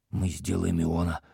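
half-whispered, breaking off into private thought; muttering to himself. A narrator's reading. Prince drifting into his own plans, muttering. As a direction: murmur it as if half to yourself. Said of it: "Князь пробормотал что-то про себя".

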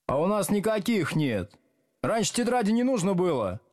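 high, insistent objection; pupils' protest. A narrator's reading. Pupils argue with teacher about notebooks, protesting.

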